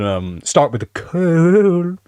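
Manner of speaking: ordinary delivery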